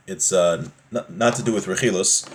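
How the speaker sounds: usual speaking voice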